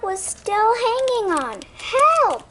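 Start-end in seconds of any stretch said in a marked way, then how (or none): 1.9-2.4 high, loud cry for help. Acted. Duck hanging from the umbrella, crying for help. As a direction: shout it while dangling. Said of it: "cried Duck".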